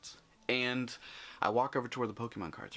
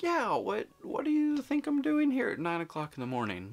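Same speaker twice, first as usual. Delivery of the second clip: mock exasperated; 12 semitones higher, 3 dB louder than usual. His own retold exasperated reply.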